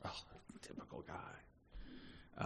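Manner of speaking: breathy, slow under-the-breath whisper; whispered aside. Real, not acted. Preacher aside to congregation, whispered under breath. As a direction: drop to a whisper for a side comment.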